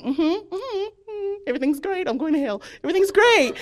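high, forced everything-is-fine; fake cheerful. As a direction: cover panic with sing-song reassurance. Pretending to co-workers she is okay.

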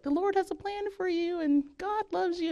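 high, sweet churchy mom voice; mom's voice. Retold or imitated. Mom preaching at her.

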